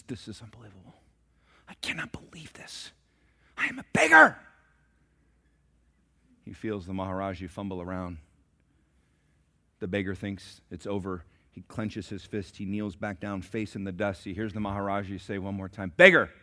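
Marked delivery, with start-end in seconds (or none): 0.0-2.9 much softer hissed disbelief; incensed whisper. Acted. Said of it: "the beggar's incensed". 3.6-4.4 high, loud indignant cry; incensed. Acted. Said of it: "the beggar's incensed". 15.9-16.3 calling out. Acted. King calls once more.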